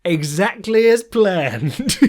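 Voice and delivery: deadpan it like a mastermind; smug fighter. Retold or imitated. Fighter to camera after a knockout, slow smug boast. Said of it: "looks at the camera like".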